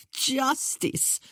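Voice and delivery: high, scoffing laugh into the word; jeering laugh. A narrator's reading. Queen jeering at a young woman's idea of justice. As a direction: laugh derisively, then toss the word back. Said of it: "The Queen's laugh was more a jeer".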